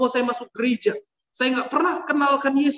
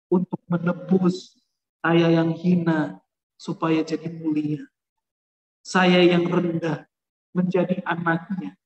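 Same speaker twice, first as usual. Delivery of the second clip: slow, lower, reverent testimony; solemn preaching; 5 semitones lower, slower than usual. Acted. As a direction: let each phrase fall slowly and reverently. Solemn climax about the cross. (khotbah khidmat, pelan dan rendah)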